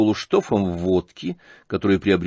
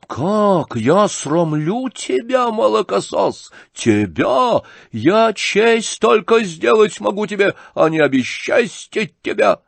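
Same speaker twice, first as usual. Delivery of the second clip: high, drawn-out, forceful indignation; outraged retort; 10 semitones higher, 4 dB louder, slower than usual. A narrator's reading. General answering his son's reproach, outraged shouting. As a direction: thunder back slowly at raised pitch.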